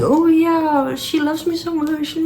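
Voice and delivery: high, sing-song mock of the author. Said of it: "She kept being like".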